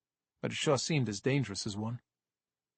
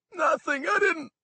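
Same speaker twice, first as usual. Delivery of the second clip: very high, loud weepy protest; sobbing denial; 5 dB louder than usual. Acted. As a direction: deny it through tears. Sobbing kid denies.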